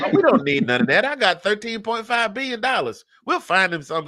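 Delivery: high, dismissive Texan tycoon; hammed-up Texas billionaire waving it off; Texas accent. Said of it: "make him sound like somebody from Texas".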